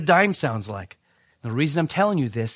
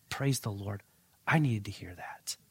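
hushed awe; 7 semitones lower, 11 dB quieter than usual. Retold or imitated. Quiet awed reaction retold.

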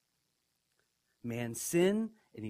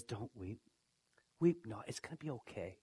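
much softer, lower, soothing; gentle comfort; 6 semitones lower, 7 dB quieter than usual. Acted. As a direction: hush him like a kind elder. Elder comforts weeping John.

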